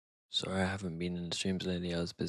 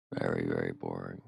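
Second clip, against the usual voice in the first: deeply bored; 12 semitones lower than usual. Real, not acted. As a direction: stretch the very in weary boredom.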